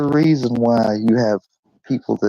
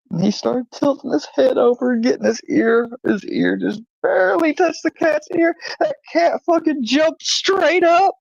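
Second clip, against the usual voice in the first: climbing higher and higher as the story peaks; telling it faster and more worked up; excited. Real, not acted. Telling a friend about the cat, rising excitement.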